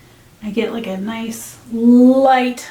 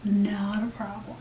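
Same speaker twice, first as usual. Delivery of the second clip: whispering; 10 dB quieter than usual. Real, not acted. Whispered reassurance.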